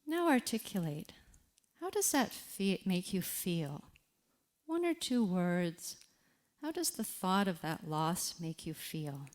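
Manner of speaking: soft, unhurried reflection guide with long pauses; walk the listener gently into their loss; guided reflection. Acted. Grief coach guiding listeners through a quiet reflection, softly.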